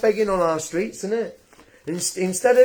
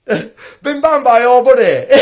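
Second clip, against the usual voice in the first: said through a laugh, slowly; chuckling at his own phrase, x0.7 slow; 2 semitones higher, 5 dB louder, slower than usual. Real, not acted.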